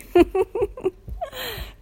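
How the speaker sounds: high, delighted laugh; real laugh at the little listener; high burst of laughter. Real, not acted. Laughs at the child.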